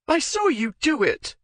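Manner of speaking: high, loud accusing cry; angry accusation. Acted. Jealous wife accusing her husband, high angry cry. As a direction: hurl the accusation at him.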